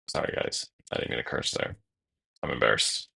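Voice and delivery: low hushed whisper of sorry; sheepish whisper after swearing; whispered apology. Real, not acted. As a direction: murmur it, embarrassed and hushed. Apologizing for cursing.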